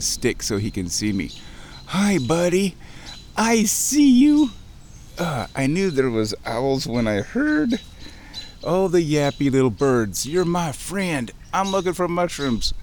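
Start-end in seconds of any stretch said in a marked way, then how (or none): 1.9-4.5 high, slow sing-song coo; real affectionate coo, much higher and louder; cooing at owl. Real, not acted. 10.3-12.7 cooing at owl. Real, not acted. Talking to the owl.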